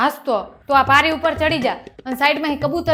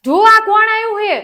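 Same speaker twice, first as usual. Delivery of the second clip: high, loud cry for help; panicked shout for Ba to hurry; alarmed yelling; 6 semitones higher, 5 dB louder than usual. Acted. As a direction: call out urgently, drawing out the words. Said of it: "તું બૂમો પાડસ".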